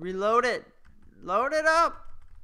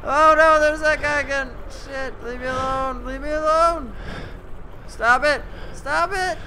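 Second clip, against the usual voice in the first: angry crying; 11 semitones higher, 11 dB louder than usual. Real, not acted. Chased again, yelling at monster.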